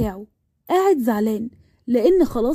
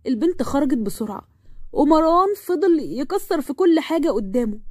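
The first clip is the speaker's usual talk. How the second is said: ordinary delivery, nothing marked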